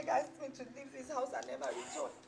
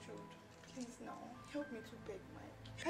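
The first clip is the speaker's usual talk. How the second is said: soft, low, desperate begging; tearful pleading; 4 semitones lower, 12 dB quieter than usual. Acted. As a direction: plead quietly for help. Begs Jude for help.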